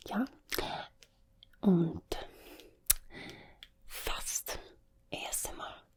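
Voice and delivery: whispered German accent. Shown as a demonstration. Recommending German ASMR creators in a whispered German accent. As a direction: whisper haltingly with German sounds, slipping into German. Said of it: "German accent".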